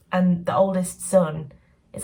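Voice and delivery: ordinary talk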